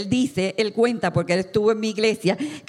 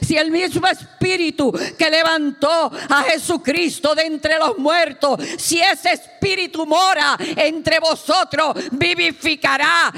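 raised, driving proclamation of scripture; fervent preaching; 7 semitones higher, 5 dB louder than usual. Retold or imitated. Preacher re-enacts Fierro repeating the verse, fervent preaching. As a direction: preach it in long, ringing phrases. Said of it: "Y él volvía a repetir".

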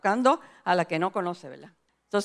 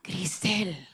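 low, soft divine call; voice of God; 4 semitones lower, 8 dB quieter than usual. Retold or imitated. God calls the praying preacher by name, soft low call. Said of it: "escucho la voz de Dios que me dice".

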